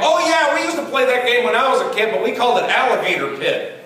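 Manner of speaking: a front-row fan's sudden recognition; audience guy. Retold or imitated. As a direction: cheerfully recall a childhood game. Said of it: "The guy was going like".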